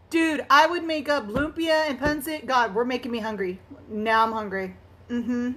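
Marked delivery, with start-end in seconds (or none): none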